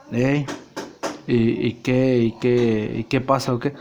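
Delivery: dropped low and murmured; Nacho's voice. Acted. Nacho asks his friend, low and soft.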